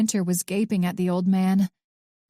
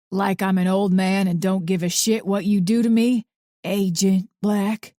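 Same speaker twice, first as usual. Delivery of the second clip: bitter vitriol; 3 dB louder than usual. A narrator's reading. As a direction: drag out Agent with contempt. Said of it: "put as much vitriol and bitterness into her title".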